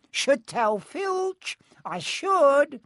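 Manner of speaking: very high, slow mock-pious voice; Peeves, saintly. A narrator's reading. Peeves threatening to tell Filch, falsely saintly. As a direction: draw it out like a squeaky little saint. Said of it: "said Peeves in a saintly voice".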